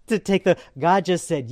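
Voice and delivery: usual speaking voice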